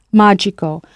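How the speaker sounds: "magico" stressed on its first syllable, MA; "magico" said as MA-gi-co, first syllable strongest. Shown as a demonstration. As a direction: lean on the "ma" of "magico". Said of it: "with that stress on the first syllable".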